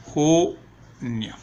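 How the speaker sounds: "Junio" split into two slow syllables. Shown as a demonstration. Teacher dictating the month as she writes, "Junio" broken into syllables. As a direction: say "Ju", pause, then "nio". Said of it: "Miren cómo se escriben".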